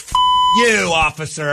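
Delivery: high-pitched mock scream at an officer; mimicked screaming. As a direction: imitate her yelling abuse, pitch thrown up.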